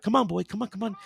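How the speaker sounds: imitating an owner calling a dog, high; coaxing master. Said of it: "original master is like".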